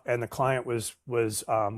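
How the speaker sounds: ordinary delivery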